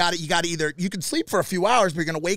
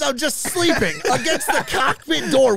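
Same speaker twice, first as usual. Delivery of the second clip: laughing retelling; 9 semitones higher, 4 dB louder than usual. Retold or imitated. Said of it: "He goes".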